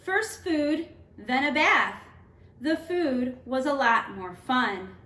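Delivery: gentle storybook read-aloud; teacher reading a picture book to students; reading to children. Acted.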